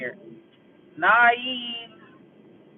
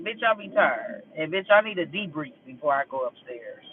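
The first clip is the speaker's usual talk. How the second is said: normal talking voice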